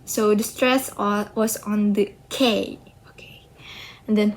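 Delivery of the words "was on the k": usual speaking voice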